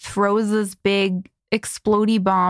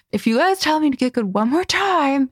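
higher-pitched mock threat; exasperated warning; 6 semitones higher than usual. Streamer retelling a warning to chat taunting "get good", mock exasperated.

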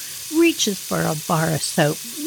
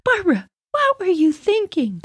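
a much higher, exasperated mom; mock scolding mother; mom asking; 12 semitones higher than usual.

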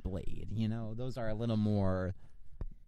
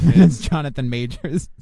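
laughing; 3 semitones higher, 12 dB louder, slower than usual. Real, not acted. Joking about mask reveal.

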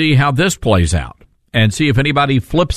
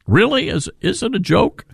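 incredulous; 8 semitones higher than usual. Real, not acted. Host scoffs at flip-floppers.

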